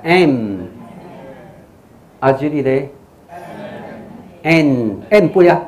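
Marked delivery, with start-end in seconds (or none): 0.0-0.6 "M" closing the lips into a nasal hum; closed-lip M. Shown as a demonstration. Teacher shows learners to close the mouth, humming "M". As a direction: end "M" with the mouth shut. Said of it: "嘴要合起来".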